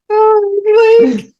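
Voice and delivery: squealing very high Link voice. Retold or imitated. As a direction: shoot the voice way up high. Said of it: "that's when his voice goes high".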